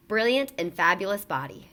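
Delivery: usual speaking voice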